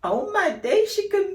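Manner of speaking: high, loud mock amazement; amazed exclaim. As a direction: squeal it like an impressed clubgoer. Friend's amazed reaction while clubbing.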